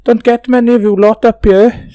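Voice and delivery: high, sing-song West Walian Kidwelly voice; Kidwelly accent. Acted. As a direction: greet outsiders in a high-pitched Kidwelly accent. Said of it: "is that North Wales?".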